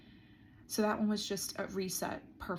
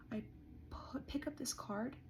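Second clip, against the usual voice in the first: whispery, much softer than usual; hushed suspense; 5 semitones higher, 10 dB quieter than usual. Retold or imitated. Telling viewers how they picked up the card, hushed. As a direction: drop to a near-whisper for suspense.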